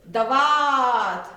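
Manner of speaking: slow stretched दवात with long वा; drawn-out word. Shown as a demonstration. Teacher to children, slowly stretching दवात. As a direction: sound out दवात carefully for the class. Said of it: "व पर लगी आ की मात्रा बन गया वा".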